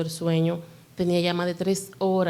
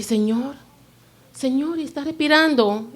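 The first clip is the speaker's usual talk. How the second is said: high, frightened gasping question; sudden fear, voice shooting up; panicked cry; 9 semitones higher, 4 dB louder than usual. Retold or imitated. Mother checking her still child, high panicked fear. Fearing her son stopped breathing.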